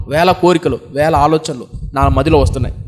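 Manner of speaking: ordinary talk, nothing marked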